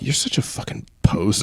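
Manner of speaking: soft, breathy, mocking under the breath; muttered sneer. Teen hero mocking the villain, soft and unvoiced. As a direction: sneer it at the villain, barely voiced.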